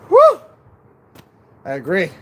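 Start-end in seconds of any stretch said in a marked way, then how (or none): 0.1-0.4 celebratory whoop. Real, not acted. Celebrating a landed trick.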